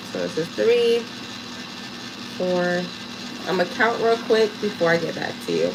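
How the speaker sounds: usual speaking voice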